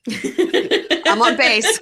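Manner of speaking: high, loud, laughing call; laughing, drawn-out mock cry; playground safe call. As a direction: claim safety like a kid in tag. Mimicking child's game safety call.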